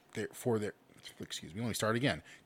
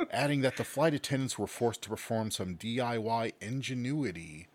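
usual speaking voice

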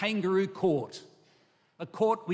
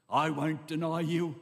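emphatic vow. Acted. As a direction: insist on loyalty, leaning on each word. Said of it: "Peter says emphatically".

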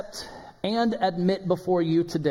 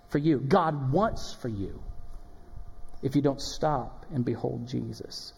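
earnest hush; 2 semitones lower, 3 dB quieter, slower than usual. Real, not acted. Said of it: "preacher drops to earnest hush".

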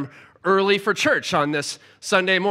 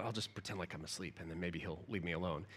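very soft, low, almost whispered; sleepy inner thought; 12 semitones lower, 21 dB quieter, faster than usual. Retold or imitated. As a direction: think it to yourself half asleep. Inner thought while feigning sleep.

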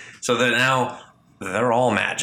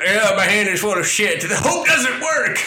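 shrill mocking yell, much higher and louder; mock outburst; 11 semitones higher, 4 dB louder than usual. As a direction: ham it up, jeering at the hope. Mocking the hope idea loudly.

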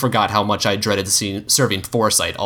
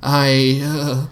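a stalled, nervous start; hesitant; 5 semitones higher, 3 dB louder than usual. Acted. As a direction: catch on the words. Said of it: "I hesitated".

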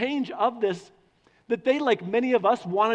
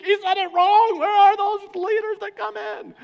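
much higher, louder put-on whiny voice; mock complaint; 12 semitones higher, 7 dB louder than usual. Preacher mocking listeners who complain, high put-on voice. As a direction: parody a grumbling churchgoer.

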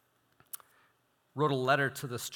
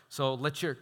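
ordinary talk

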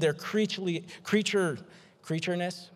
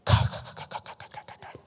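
voiceless stuttering throat rumble; crackling mouth-made thunderclap, trailing away; bad thunder impression. Shown as a demonstration. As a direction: fake thunder with rattling k sounds. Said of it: "I'll work on my thunder".

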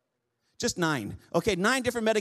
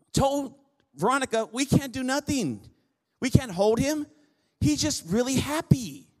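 higher-pitched, emphatic sermon delivery; impassioned preaching; 6 semitones higher than usual. Real, not acted. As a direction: preach it out to the congregation with a raised voice. Preaches with raised voice.

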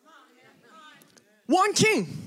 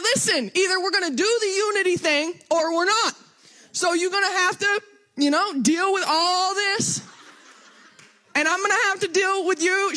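animated retort; 6 semitones higher than usual. Retold or imitated. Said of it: "And I'm like".

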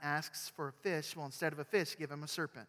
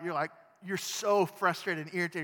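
irritated; 4 semitones higher, 8 dB louder than usual. A narrator's reading. Building annoyance.

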